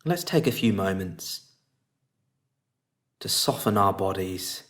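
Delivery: guided meditation. Real, not acted. Teacher guiding listeners to relax face and body, calm meditation voice.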